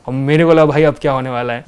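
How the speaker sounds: ordinary delivery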